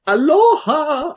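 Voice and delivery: drawn-out high Aloha; excited cheer. Retold or imitated. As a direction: sing out a slow happy Aloha. Dog announces surfing retirement.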